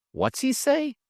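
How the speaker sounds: lightly voiced eager young man; a touch of a curious youngster; young Trip. Trip asks Travis what the old man said, lightly voiced. Trip's voice higher.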